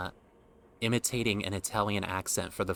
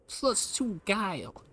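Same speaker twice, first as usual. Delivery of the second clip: much higher, slow cartoon sidekick voice; like Donkey; 11 semitones higher, slower than usual. Reading urchin stats, much higher Donkey-like voice. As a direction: pitch it up like a chatty cartoon donkey. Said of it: "I kinda sound like Donkey".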